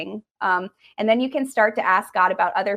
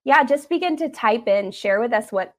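ordinary talk

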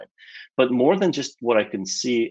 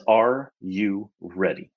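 slow, measured question; angel's voice retold; much slower than usual. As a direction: space out each word at normal volume. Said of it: "It's the best way I can describe it".

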